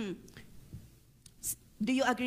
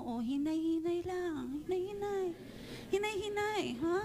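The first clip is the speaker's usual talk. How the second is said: softly urging, higher and quieter; soft coaxing; 4 semitones higher, 5 dB quieter than usual. Pretend. Congregation told to tell their neighbour, soft coaxing. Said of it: "sabi mo sa katabi mo".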